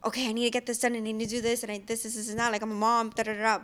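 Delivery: ordinary talk, nothing marked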